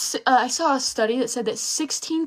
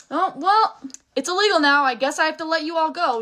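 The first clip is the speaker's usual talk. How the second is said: mock dismissive slave owner, higher and louder; 6 semitones higher, 4 dB louder than usual. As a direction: sneer it out as a careless slaveholder. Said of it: "those people that owned other people were just like".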